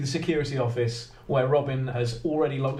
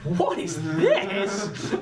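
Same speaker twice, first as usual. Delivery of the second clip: slow, higher, delighted curiosity; intrigued and stretched out, pitched up; Alison; 5 semitones higher, much slower than usual. Acted. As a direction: marvel at a new toy, drawing the words out. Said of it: "Alison just looks at it and goes".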